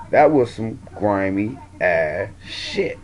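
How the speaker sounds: dragged out, every word weighted; slow emphatic verdict. Real, not acted. Vlogger condemning the women's plotting, slow and deliberate. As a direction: drawl it out slowly to drive it home.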